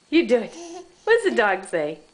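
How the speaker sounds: ordinary talk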